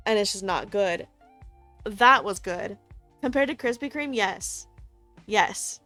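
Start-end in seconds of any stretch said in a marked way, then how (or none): none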